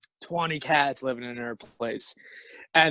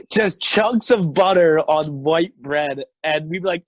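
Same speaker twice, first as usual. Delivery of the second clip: ordinary delivery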